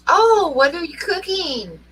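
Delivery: high, slow sing-out question; curious dinner guest. Retold or imitated. Storyteller voicing a friend who walks into the kitchen, curious. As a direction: imitate an innocently nosy visitor.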